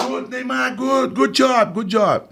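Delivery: very high, excited good job; gushing praise. Retold or imitated. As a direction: heap praise on the star.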